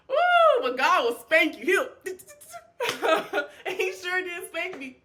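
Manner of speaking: high squeal then a loud laughing warning; playful loud warning with a laugh; excited laughing outburst. Real, not acted. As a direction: burst out, half laughing.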